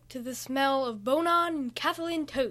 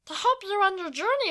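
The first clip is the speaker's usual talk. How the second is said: high, louder creature voice; small creature's raised voice; high-pitched little creature; 8 semitones higher, 7 dB louder than usual. A narrator's reading. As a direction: chirp it like a tiny creature. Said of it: "said in a high-pitched voice".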